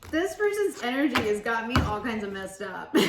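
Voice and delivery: laughing through the words, giggly. Real, not acted. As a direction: giggle while you admit it.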